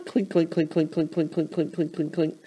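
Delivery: clinking coaster chain. Shown as a demonstration. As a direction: imitate the chain pulling the car uphill, playfully. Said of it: "let's hear it the whole way through".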